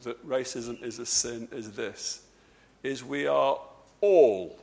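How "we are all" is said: slow "we are all" with heavy weight on "all"; "all" drawn out and set apart; stress on ALL. Real, not acted. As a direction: lean hard on the word "all", then pause. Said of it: "emphasis on the word all".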